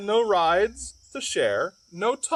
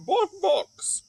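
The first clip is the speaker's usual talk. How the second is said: clucking; 12 semitones higher than usual. Acted. Barnyard sounds listed.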